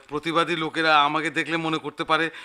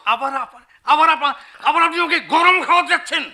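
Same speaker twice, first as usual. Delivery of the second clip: higher, louder rebuke; angry scolding; 8 semitones higher, 6 dB louder than usual. Acted. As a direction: snap at the servant. Manager scolds peon. (রাগী ধমক)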